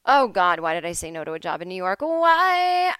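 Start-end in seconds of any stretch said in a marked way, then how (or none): 2.0-2.9 high, loud cry of regret; anguished wail. Real, not acted. Comic regret over a lost New York job, loud high wail. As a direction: wail it to the sky.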